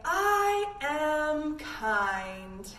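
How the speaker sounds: drawn-out, clear chant; slow affirmation. Shown as a demonstration. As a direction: say it slowly for the class to echo. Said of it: "and say".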